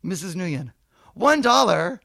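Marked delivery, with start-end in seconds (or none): 1.1-2.0 higher, louder put-on accent, outraged; shrieking about a one-dollar balance; mock Vietnamese mother. Mrs Nguyen complaining to the Kmart manager, higher mock accent.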